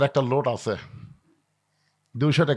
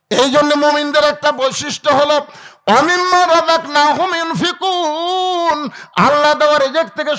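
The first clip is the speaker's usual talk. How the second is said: high, loud chanting sermon delivery; full-force preaching; 9 semitones higher, 5 dB louder than usual. Real, not acted. Preaching and reciting Quran loudly. (উঁচু সুরে ওয়াজ ও তিলাওয়াত)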